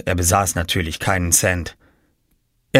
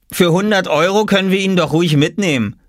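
higher boy's voice, mocking; lightly voiced teasing boy; teasing brother; 9 semitones higher, 3 dB louder than usual. A narrator's reading. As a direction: poke fun at Oskar.

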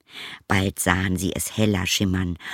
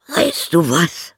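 high, slow, drawn-out donkey voice; donkey character; 9 semitones higher, 4 dB louder, slower than usual. Acted. Donkey has an idea for the dog, high donkey voice. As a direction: pitch it high and stretch it.

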